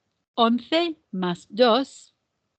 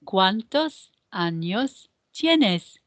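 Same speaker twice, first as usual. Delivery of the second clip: "¿cuántos años tienes?" slowly, small gaps between words; measured repeat-after-me delivery of the question; slow choral model; much slower than usual. Shown as a demonstration. Teacher repeats the question otra vez, slow word-by-word model. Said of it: "Otra vez".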